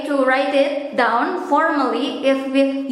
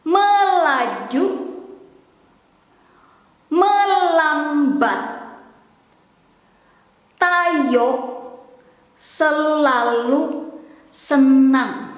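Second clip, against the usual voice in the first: spoken word by word with long gaps; careful, slow teacher's enunciation; slow pronunciation demo. Shown as a demonstration. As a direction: draw out each syllable for learners. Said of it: "now we will try to pronounce slowly".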